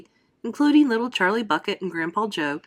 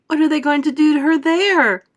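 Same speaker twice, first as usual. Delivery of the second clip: frightened; 8 semitones higher, 8 dB louder than usual. A narrator's reading. Mother frightened.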